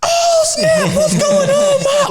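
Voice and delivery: put-on very high, excited little kid; hyped kid voice. Boy shouting to his mom on air, very high and excited. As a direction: squeal it out like a thrilled child.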